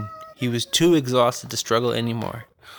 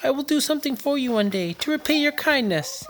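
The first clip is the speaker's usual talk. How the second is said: high, pleading mouse voice; 12 semitones higher than usual. Acted. The mouse begging the lion not to eat him, high squeaky plea. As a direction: beg like a tiny mouse. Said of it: "the mouse pleaded".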